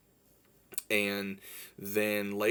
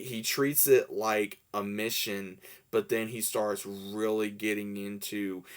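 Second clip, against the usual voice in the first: normal talking voice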